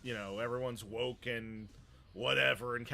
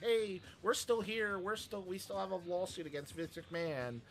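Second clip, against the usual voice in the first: higher, lightly singsong mock plea; mocking reminder; 6 semitones higher, 4 dB quieter than usual. Host imitating lawyers' repeated statements, mildly mocking. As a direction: pipe up as if begging for notice.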